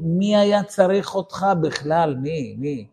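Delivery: cursing the day. Retold or imitated. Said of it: "מקלל את היום".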